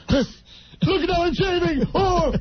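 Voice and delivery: high, slow hammed-up clowning; comedy-role Robin Williams. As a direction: whoop it up like a zany comic. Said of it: "And the comedy roles".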